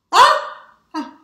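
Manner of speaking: high loud joyful shout of surprise; loud high cry of joy. Real, not acted. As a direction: burst out in joy. Sudden healing cry.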